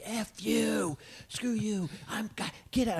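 high, soft mock yell; angry attendant imitation. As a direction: mimic a man yelling, high but held back. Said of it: "And he's like".

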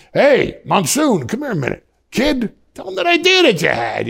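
lightly voiced boss calling a kid over. Manager summons the kid to share an idea, calling out.

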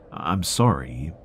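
ordinary delivery

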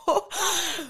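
a burst of happy, disbelieving laughter; giddy laugh; delighted laugh. Real, not acted. Overjoyed at answered prayers.

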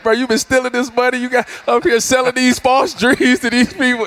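playful sideline trash talk to the coach. Retold or imitated. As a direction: needle him, half-laughing at your own jab. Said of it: "get to talking to him like".

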